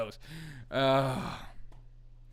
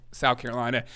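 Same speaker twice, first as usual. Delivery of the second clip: ordinary talk, nothing marked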